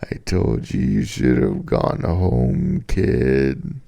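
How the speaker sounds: hammed-up haunting old man's warning; eerie echoing old man. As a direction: let it ring out like a voice echoing in the dark. Old man's warning echoes again.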